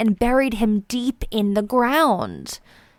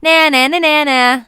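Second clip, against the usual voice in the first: singsong na na, higher and louder; taunting; 4 semitones higher, 5 dB louder than usual. A narrator's reading. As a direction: tease the squirrels like a kid. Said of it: "taunting the squirrels".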